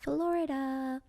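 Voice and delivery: FLORIDA stretched out slowly and pitched high; drawn-out emphasis. Real, not acted. Echoing the co-host on how far he flew, high drawn-out emphasis on Florida. Echoes co-host's word for emphasis.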